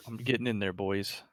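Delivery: ordinary delivery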